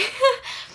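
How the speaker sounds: high laugh through tears; teary laugh. Real, not acted. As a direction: laugh while crying happily.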